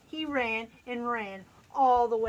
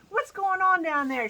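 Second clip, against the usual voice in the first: high, loud call from above; sleepy squirrel shouting down; 8 semitones higher, 7 dB louder than usual. A narrator's reading. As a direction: shout down from the treetop. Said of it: "shouted a sleepy-eyed squirrel".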